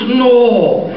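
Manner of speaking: loud, high, drawn-out Irish disbelief; hammed-up amazed Irish brogue; drunk Irishman. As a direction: balk loudly like a tipsy Irishman. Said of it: "the Murphy twins are drunk again".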